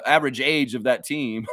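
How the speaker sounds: normal talking voice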